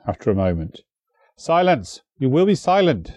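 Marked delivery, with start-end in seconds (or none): 1.4-3.1 mild raised shout, higher pitch. A narrator's reading. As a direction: command silence sharply. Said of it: "Peredur shouted at us".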